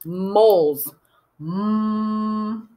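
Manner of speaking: MOLES with a long humming M; sounded out. Shown as a demonstration. Teacher sounds out end of animals, holding the M. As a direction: hold the M of "Moles" as a hum. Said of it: "I have to sound that out".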